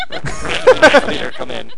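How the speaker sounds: laughing pilot radio call; slow laughing radio call. Pretend. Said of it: "Can you just say red leader?".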